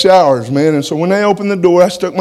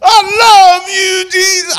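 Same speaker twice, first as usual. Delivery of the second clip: much higher, loud, slow holler of joy; hollering praise; 5 dB louder, slower than usual. Retold or imitated. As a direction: shout it up to the ceiling in rapture. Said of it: "I started hollering".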